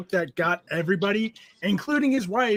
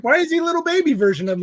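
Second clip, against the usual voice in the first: indignant, fast; 7 semitones higher, 4 dB louder, faster than usual. Retold or imitated. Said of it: "I was like".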